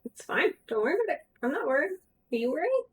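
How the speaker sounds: high squeaky voice. Pretend. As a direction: parrot it back in a tiny high voice. Squeaky voice echoes the line.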